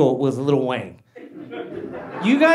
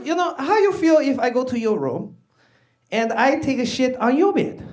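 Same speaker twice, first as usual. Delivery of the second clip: higher accented imitation of dad; dad's voice, pitched up; lecturing dad; 6 semitones higher than usual. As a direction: put a pointed question as dad. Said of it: "He goes".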